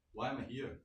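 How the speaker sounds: ordinary talk, nothing marked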